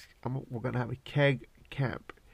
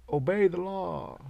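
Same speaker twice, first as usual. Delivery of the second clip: slow, higher mock command; mock authority; 6 semitones higher, 3 dB louder than usual. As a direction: bark it like a stiff cop. Mocking authority.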